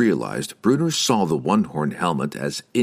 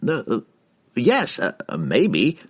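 halting, higher, flustered answer; attempted confidence; 5 semitones higher than usual. A narrator's reading. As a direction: try to sound sure while faltering. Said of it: "proclaimed as confidently as he could".

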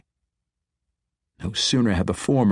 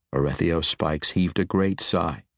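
ordinary delivery, nothing marked

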